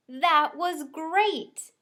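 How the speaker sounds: enthusiastic. A narrator's reading. Cassie praising the performance.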